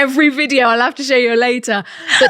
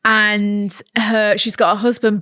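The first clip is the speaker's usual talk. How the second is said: usual speaking voice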